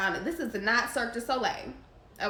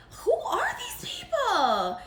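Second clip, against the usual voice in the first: high laughing "who are these people"; laughing disbelief; slower than usual. Real, not acted. Host laughing at a viewer's comment, high and slow.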